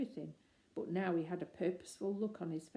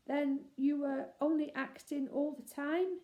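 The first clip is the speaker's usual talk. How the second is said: faltering; 8 semitones higher, 4 dB louder than usual. Acted. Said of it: "he faltered".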